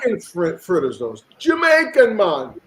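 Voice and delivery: bad Jamaican accent, high and loud on Jamaican; hammed-up Jamaican accent. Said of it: "your Jamaican accent is trash".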